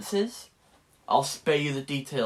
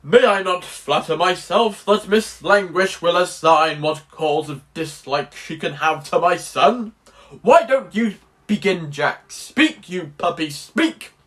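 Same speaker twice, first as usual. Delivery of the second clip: loud, declaimed period stage delivery; theatrical old-fashioned bluster, forceful and loud; play character Anthony Absolute; 6 dB louder than usual. Acted. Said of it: "one of my lines were".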